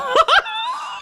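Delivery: very high, helpless cackle; shrieking laugh. Real, not acted. Storyteller breaking up mid-judge, high helpless laughter. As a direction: break character with a squealing laugh.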